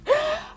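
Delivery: short squeaky giggle, very high; a burst of proud giggling up high. Real, not acted. Proud of her plants, laughs.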